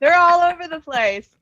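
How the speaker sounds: high, loud shout to the party; screamed warning. Acted. Player has Rhea warn of attackers all around, screamed out. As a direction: call out the danger at full voice. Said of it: "Rhea would scream out".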